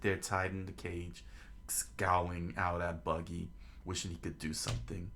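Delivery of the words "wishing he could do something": usual speaking voice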